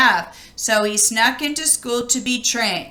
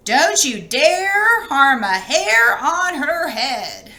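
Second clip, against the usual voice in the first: slow, firm and loud, with a laugh in it; a tough granny drawing the line; stern granny warning; 3 semitones higher, 4 dB louder, slower than usual. Acted. Granny scolding the wolf, slow and firm.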